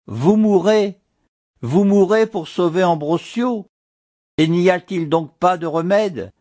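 voix plus haute, suppliante et pressante; il la supplie de vivre; désespoir égaré. A narrator's reading. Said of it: "l'égarement du désespoir". (Ambrosio supplie Mathilde, voix haute et désespérée)

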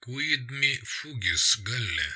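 slow, very soft Latin quote; hushed echo. A narrator's reading. Narrator echoing the Latin line, hushed and slow. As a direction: recite it softly, drawn out.

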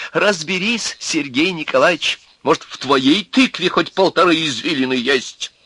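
gloating, insulting challenge; vengeful gloating. A narrator's reading. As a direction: needle him with spiteful relish. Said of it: "с мстительным злорадным чувством говорил Князев".

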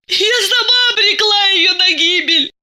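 very high, sobbing self-accusation; tearful confession. A narrator's reading. Masha blames herself for the death, sobbing.

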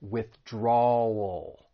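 withdrawal drawn out slowly into a dark L. Shown as a demonstration. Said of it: "has that ul sound".

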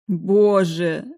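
anguished cry. Acted. Sobbing after the killing.

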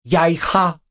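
high squeaky bug voice; cockroach voice. Acted. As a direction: squeak it like a tiny insect. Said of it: "с лёгким сомнением пискнул".

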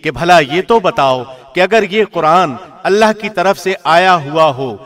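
ordinary delivery

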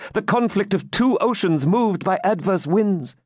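high-pitched, urgent eyewitness report; excited maiden. Acted. Maiden at lattice reports battle to knight, agitated. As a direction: call the battle high and fervently.

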